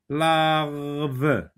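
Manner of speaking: "Larve" drawn out, with a long r before "ve"; sounding out. Shown as a demonstration. Teacher tests syllable combinations, "Larve" slowly blended as lar-ve.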